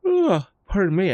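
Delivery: talking through a yawn. Real, not acted. Streamer excusing a yawn to viewers, yawning. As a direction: pardon yourself mid-yawn. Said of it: "I don't know why I'm yawning".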